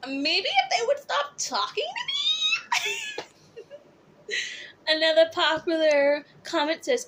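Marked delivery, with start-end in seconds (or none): 0.1-4.3 high, slow sassy drawl; sassy drag queen. Acted. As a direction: stretch it out with high sass.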